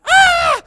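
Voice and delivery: loud, high-pitched startled scream; mock scream. Husband imitating wife finding prank in shower, mock scream. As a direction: imitate a sudden shriek of fright.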